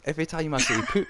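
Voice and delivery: can't get through it without laughing; giggling. Real, not acted. Host trying to say poop, giggling. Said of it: "you've set me off".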